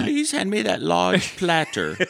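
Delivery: high, very slow and precise; a slow, high put-on butler voice; mock bit voice. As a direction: drag each word out politely. Acting out a comedy bit.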